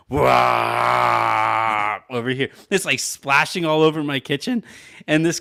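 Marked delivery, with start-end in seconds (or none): 0.1-2.0 long, loud mock vomit "waaah"; imitated projectile vomiting. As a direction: spew out a drawn-out retching roar. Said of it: "he's just going".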